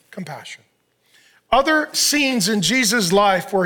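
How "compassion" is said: very soft, low single word; hushed summary. Real, not acted. Pastor names the lamb's heart after voicing Jesus, very soft. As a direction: breathe the word out gently.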